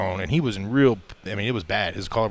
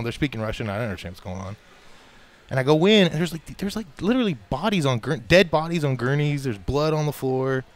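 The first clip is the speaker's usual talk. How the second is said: ordinary delivery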